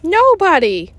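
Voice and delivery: plain reading voice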